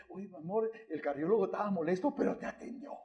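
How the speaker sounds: hushed, intimate aside to husband; wife's soft whisper. Retold or imitated. Wife to husband after the consultation, hushed. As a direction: murmur it close, tenderly.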